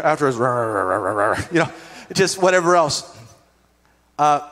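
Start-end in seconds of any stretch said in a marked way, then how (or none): 0.0-1.4 much lower grumbled rah rah; mock cursing under breath. Churchgoer grumbling about a brother after blessing him, low mock mutter. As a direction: growl nonsense curses under your breath. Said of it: "cursing under our breath".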